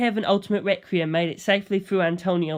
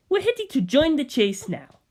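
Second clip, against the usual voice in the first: higher, eager; urgent gangster; 8 semitones higher than usual. Acted. Bucciolati voiced.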